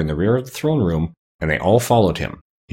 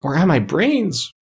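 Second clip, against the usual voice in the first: high, drawn-out lament; wailing; 9 semitones higher, slower than usual. A narrator's reading. Scarecrow despairs of getting brains, wailing. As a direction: wail it slowly without extra volume. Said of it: "wailed the Scarecrow".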